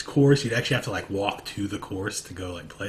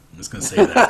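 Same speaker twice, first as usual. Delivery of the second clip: higher, louder, laughing through it; 14 semitones higher, 9 dB louder, faster than usual. Real, not acted. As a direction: laugh while confessing. Admits never playing, laughing.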